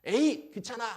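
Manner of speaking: high, loud mock baby irritation. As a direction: burst out like a fed-up baby. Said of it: "근데 좀 지나면 어떻게 됩니까". (귀찮은 듯 높고 크게 짜증 내며)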